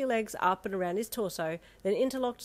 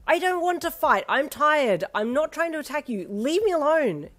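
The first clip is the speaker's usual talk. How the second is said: loud, high pleading shout; a weary fighter yelling to be left alone; 8 semitones higher, 8 dB louder than usual. A narrator's reading. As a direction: shout them off, tired and desperate. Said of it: "you shouted".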